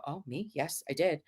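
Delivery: soft sheepish reply; timid small voice. Retold or imitated. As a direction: shrink and answer softly.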